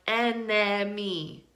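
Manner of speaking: "enemy" mispronounced as en-EH-mee; unreduced middle syllable in "enemy"; wrong full second vowel. Shown as a demonstration. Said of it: "It does not sound like".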